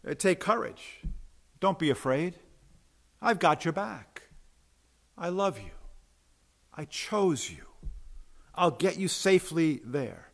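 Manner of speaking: soft, tender voice with pauses between phrases; gentle reassurance. Acted. Preacher voices Jesus comforting frightened disciples, gentle reassurance. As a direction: reassure softly, letting each promise settle. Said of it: "Instead, he says".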